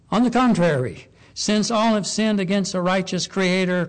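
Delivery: loud, rising correction; a preacher's firm "On the contrary"; emphatic rebuttal. Acted. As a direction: cut them off firmly.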